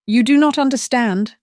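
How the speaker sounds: a desperate son breaking down; sobbing plea. Acted. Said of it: "he sobbed".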